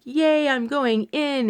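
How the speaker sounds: high, slow, sing-song yay; mock eager cheer. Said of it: "Normally, I'm like".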